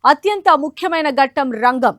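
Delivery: crisp studio news anchor delivery; news read. Acted. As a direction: read it like a TV bulletin. Anchor reads news bulletin.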